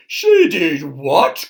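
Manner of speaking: loud, drawn-out outraged disbelief; shocked cry. A narrator's reading. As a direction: cry out as an appalled king. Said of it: "cried the king".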